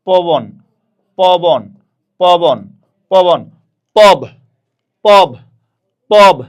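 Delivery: "পবন" loudly repeated, then cut down to "পব"; loud teacher's model of "পবন" broken to "পব"; pronunciation drill. Shown as a demonstration. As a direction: call out "পবন" and "পব" one at a time. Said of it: "উচ্চারণ করেন আমার সাথে সাথে".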